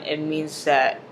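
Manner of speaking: normal talking voice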